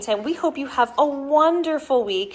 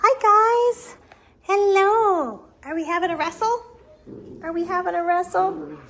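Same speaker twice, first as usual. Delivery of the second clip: high sing-song cooing; puppy talk; 9 semitones higher, 3 dB louder than usual. Real, not acted. Owner cooing at wrestling puppies in high baby talk. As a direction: coo at them like babies.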